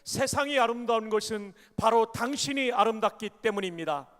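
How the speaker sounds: loud, ringing line for the congregation to repeat; call for repetition. Shown as a demonstration. Preacher leading the congregation in a repeated line, proclaiming loudly. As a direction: proclaim it so the church can echo you. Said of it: "이렇게 한번 따라 해 주십시오".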